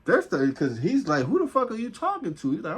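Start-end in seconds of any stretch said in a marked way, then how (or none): 1.3-2.5 high-pitched, indignant challenge; retold confrontation. One partner challenging the other before a fight, mock indignant. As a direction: imitate a man squaring up, voice pitched way up. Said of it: "they're about to start fighting".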